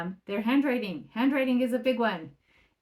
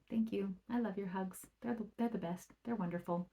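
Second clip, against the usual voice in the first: soft, loving thanks; 9 dB quieter than usual. Retold or imitated. Thanking child for a hug.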